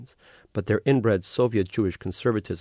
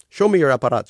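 firm, louder command; 5 semitones higher, 8 dB louder, faster than usual. A narrator's reading. Older woman orders the young man to hand over his device, louder. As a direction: give the order, no room for refusal. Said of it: "she commanded".

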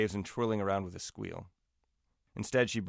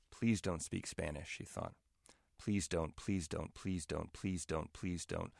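silent desperate plea; 3 semitones lower, 8 dB quieter, slower than usual. Acted. Inner pleading as mother stops.

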